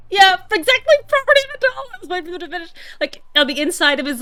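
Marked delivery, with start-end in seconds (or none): 0.1-2.7 fast words through laughter; laughing while talking. Real, not acted. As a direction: talk while cracking up. Cracking up mid-sentence.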